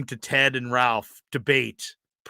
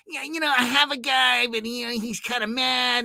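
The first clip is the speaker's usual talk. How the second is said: imitating Ralph; 11 semitones higher than usual. Retelling Ralph's reply to him.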